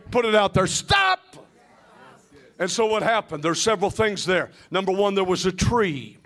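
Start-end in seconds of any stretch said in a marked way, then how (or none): 0.7-1.2 high, sudden shout; shouted command. Real, not acted. Preacher leading the congregation, shouted command. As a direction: call it out high and forceful.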